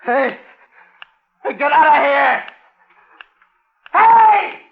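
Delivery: yelling high and loud at a noise; panicked shouts. Acted. Stranded man yells at unseen creature. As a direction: scream it into the darkness. Calls at something in dark.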